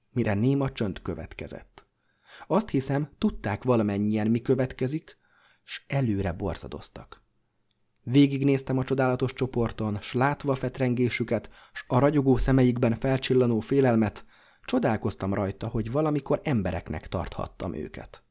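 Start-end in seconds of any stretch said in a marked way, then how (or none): none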